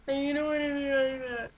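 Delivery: high, smeared wailing; overdone fake crying. Shown as a demonstration. Acting coach showing a bad version, overdone unintelligible crying. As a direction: blubber it until the words are barely understandable. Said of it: "where you can't understand what you're saying".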